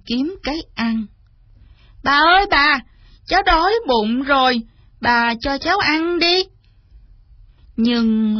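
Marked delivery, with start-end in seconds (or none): 2.0-6.4 put-on high, eager child calling to grandma; hungry little boy.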